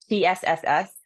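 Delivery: long tsss through the teeth; steam hiss. Shown as a demonstration. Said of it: "tongue is right at the back of the teeth".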